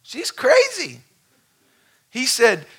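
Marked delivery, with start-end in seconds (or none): 0.0-1.0 hammed-up outrage to the boss; mock exasperated agent complaining to his boss about the lady; mock frustrated agent. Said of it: "he's frustrated and mad".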